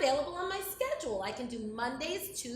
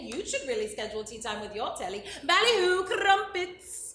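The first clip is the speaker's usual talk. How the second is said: fake British accent. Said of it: "horrible British accent".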